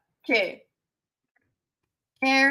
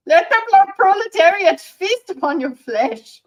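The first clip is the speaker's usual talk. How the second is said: loud, higher rallying-cry read of "proletariat"; dramatic reading; 6 semitones higher, 9 dB louder than usual. Shown as a demonstration. Reading a chat message aloud as a loud revolutionary proclamation. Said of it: "really good".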